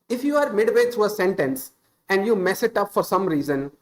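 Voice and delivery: normal talking voice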